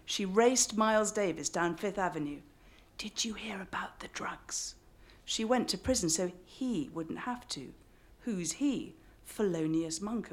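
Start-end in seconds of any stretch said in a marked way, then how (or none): 3.0-4.8 hushed rumor-mongering whisper; gossipy whisper. Acted. Gossiping aunt whispering about drugs.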